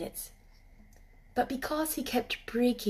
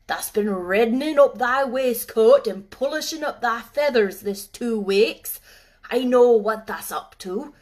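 louder, mocking Yorkshire old man; sarcastic old gardener; 8 dB louder than usual. Ben mocking the robin's courting, sarcastic old Yorkshireman. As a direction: tease the bird mercilessly. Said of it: "But Ben was sarcastic".

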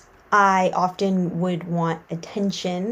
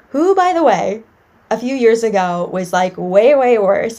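loud excited aside; 2 semitones higher, 8 dB louder than usual. Real, not acted. Excited aside about her past self.

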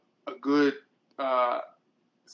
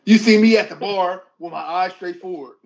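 loud, higher, defensive protest; indignant protest; 4 semitones higher, 11 dB louder than usual. Acted. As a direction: shout your innocence at her. Defending himself to jealous girlfriend.

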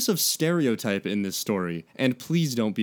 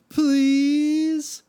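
pleading whine; 5 dB louder than usual. A narrator's reading. Mike begging.

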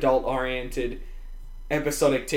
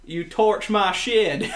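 slow, drawn-out American Southern drawl; Southern accent; 5 semitones higher, much slower than usual. Shown as a demonstration. Co-host asked to do a Southern accent for us, slow Southern drawl. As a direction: do a Southern accent, stretching the words, higher than usual. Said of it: "Do a Southern accent for us".

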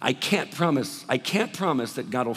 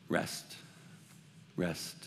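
much softer, almost breathed; soft "rest"; 7 semitones lower, 13 dB quieter than usual. Real, not acted. Pastor telling weary listeners to rest, very softly. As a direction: lay the word down gently.

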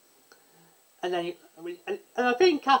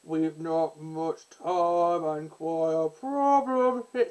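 slow, lower try at a deep bass; slow mock bass voice, barely lower; attempted bass voice; 4 semitones lower, slower than usual. Shown as a demonstration. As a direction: reach for a deep villain voice and miss. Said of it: "that's not a bass tone".

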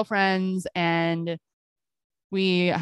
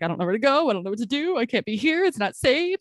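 panicked pleading; 4 semitones higher than usual. Retold or imitated. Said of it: "I was literally, like, sobbing".

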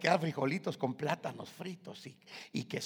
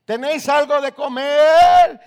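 high, loud shouted question; Jesus calling to the boat; calling across the water; 12 semitones higher, 23 dB louder than usual. Acted. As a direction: shout to the fishermen from the shore.

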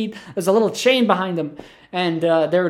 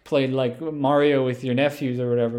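ordinary delivery

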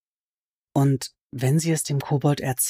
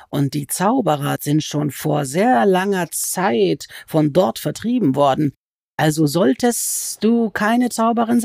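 high, slow, wheedling creature voice that climbs on each nein; sly goblin voice; 4 semitones higher, 4 dB louder, slower than usual. Acted. Goblin crouched over Alice, complaining she is a sorceress, in a high sly voice. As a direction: stretch the words like a gloating, sulky goblin.

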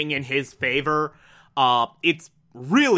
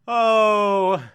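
laughing pity; 2 semitones higher, much slower than usual. Real, not acted. Pitying laugh at naivety.

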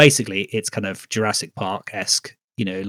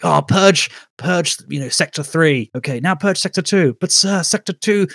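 high, loud frantic radio shouting; panicked soldier; 7 semitones higher, 7 dB louder than usual. Retold or imitated. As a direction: yell it like things are falling apart.

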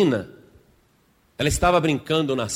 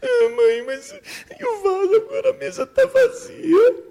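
put-on high, loud childish wailing; hammed-up sobbing child; crying little girl; 5 dB louder than usual. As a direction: whine tearfully like a small child. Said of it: "já falou pra você não chorar".

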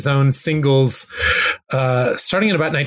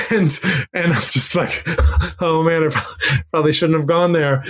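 chuckling; 2 semitones higher than usual. Real, not acted. Chuckling while retelling his awkward reaction.

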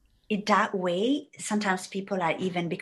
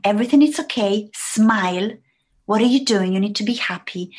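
stern father; 3 semitones higher, 7 dB louder, faster than usual. Retold or imitated. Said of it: "his dad always told him".

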